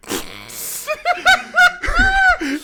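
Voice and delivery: a burst of shrieking laughter high up; loud high laugh. Real, not acted. Recalling the funny moment.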